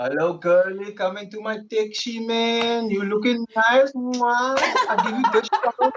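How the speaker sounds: put-on cheeky Cape Town accent; Cape Town taxi accent. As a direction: sweet-talk a passenger in Cape Coloured accent. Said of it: "I can't do that accent".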